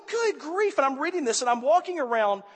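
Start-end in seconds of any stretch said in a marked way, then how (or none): 0.1-0.8 exasperated. Retold or imitated. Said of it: "I'm like, going".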